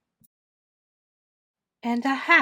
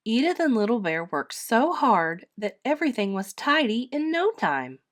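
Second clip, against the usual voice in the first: usual speaking voice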